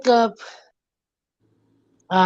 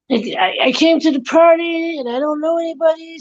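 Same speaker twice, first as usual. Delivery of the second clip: high, chatty skit voice; party mingler; 8 semitones higher than usual. Acted. Party guest who knows nobody, chatty mingling. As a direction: ramble in a raised small-talk voice. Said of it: "mingle and have a conversation".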